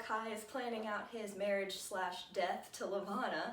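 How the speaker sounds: usual speaking voice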